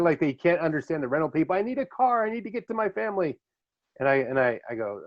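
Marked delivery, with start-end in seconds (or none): 1.5-3.4 high-pitched mock pleading; freaking-out Italian man. Italian man pleading at the rental counter, mock freak-out. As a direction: imitate a man frantic to get going. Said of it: "He's freaking out".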